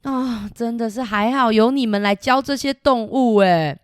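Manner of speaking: ordinary delivery, nothing marked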